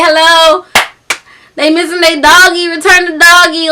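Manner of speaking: put-on high, loud little-kid voice; little baby. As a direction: mimic a small child pleading for a dog. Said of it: "a little baby wrote this flyer".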